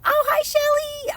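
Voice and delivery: high happy surprise; delighted to spot a friend; surprised greeting. Real, not acted. Greets a newcomer joining.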